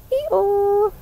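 a playful donkey bray for the nursery. Acted. Donkey introduced to children.

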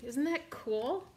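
ordinary delivery

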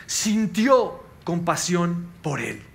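sintió compasión por él drawn out slowly, every word weighted; slow emphasis. Acted. Preacher to congregation, slow and weighty. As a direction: lean on sintió and let the phrase land slowly. Stressing the key verse.

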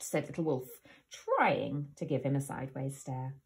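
ordinary delivery

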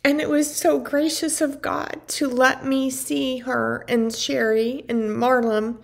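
ordinary talk

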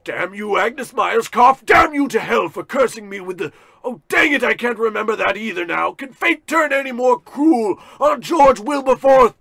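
high, loud ranting tantrum; furious shouting. Acted. Cursed man at his safe, shouting wildly. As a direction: scream your rage at the curse.

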